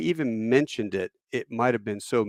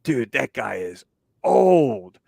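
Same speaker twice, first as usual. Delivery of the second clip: slow, drawn-out mock disbelief; mocking young officer; 5 dB louder, much slower than usual. Young lieutenant scoffing at an old colonel, slow mock disbelief. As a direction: sneer it like a cocky young lieutenant.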